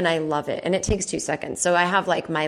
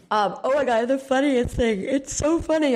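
talking through a yawn, pitched up; 6 semitones higher than usual. Real, not acted. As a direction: stretch words out mid-yawn. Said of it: "I'm yawning".

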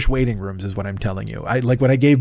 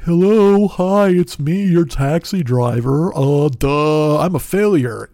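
pathetic dork; 2 semitones higher, 3 dB louder, much slower than usual. Pretend. Driver mocks himself calling a no-show rider, dopey dork voice. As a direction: play the sad, dopey loser on the phone.

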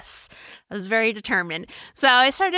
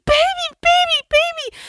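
put-on high, loud toddler calling baby; 5 dB louder than usual. Mom imitating her daughter calling for baby. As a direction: cry out baby like a little girl. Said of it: "That's how she says baby".

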